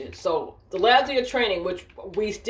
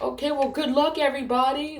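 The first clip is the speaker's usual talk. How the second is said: higher-pitched, uneasy send-off; mock cheery farewell; 7 semitones higher than usual. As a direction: imitate a doubtful goodbye to the group. Retold farewell to doomed trainees.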